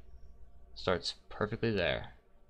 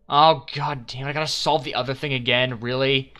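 louder, higher, fed-up complaint; exasperated outburst; 4 semitones higher, 7 dB louder than usual. Real, not acted. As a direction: gripe loudly at the game. Annoyed at redoing the puzzle.

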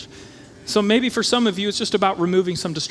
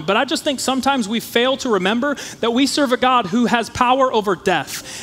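higher pitch, building in force; impassioned preaching; 5 semitones higher, 4 dB louder than usual. Real, not acted. Preacher reminding the congregation whom they serve, impassioned preaching. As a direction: drive each clause up to a louder peak.

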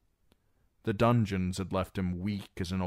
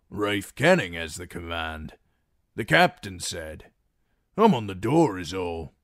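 louder, gruff-sounding common soldier; lightly voiced rough guard; 6 dB louder than usual. Guard explains to Reek who commands, a rough soldier voice. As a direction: answer like a lowly doorman.

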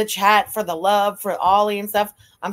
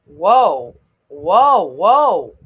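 much louder, falling alarmed whoas; shocked whoa; 4 semitones lower, 9 dB louder, much slower than usual. Real, not acted. Host stunned by Chantal's death wish, loud alarmed whoa. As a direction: stop her in alarm.

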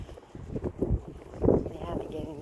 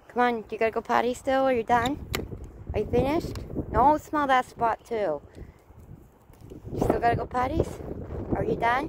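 high, louder sing-song questions to the dog; 9 semitones higher, 4 dB louder than usual. Real, not acted. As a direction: coax and check on the dog. Asking the dog if done.

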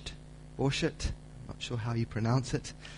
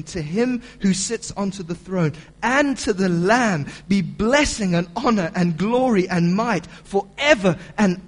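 preaching at full force; 4 semitones higher, 7 dB louder than usual. Acted. Preacher reading John's vision to the church, loud and building. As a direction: read it like a herald.

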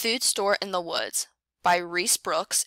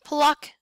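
a high, louder pop on pluck; popped pluck sound; 7 semitones higher, 4 dB louder than usual. A narrator's reading. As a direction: snap the word out like a plucked stem. Blue jay plucks acorn.

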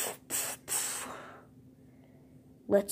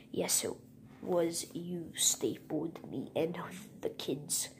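whisper; 10 dB quieter than usual. Pretend. Villain toy plots revenge.

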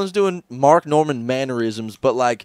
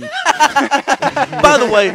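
laughing; 12 semitones higher, 4 dB louder, much slower than usual. Acted. Laughing put-on voice.

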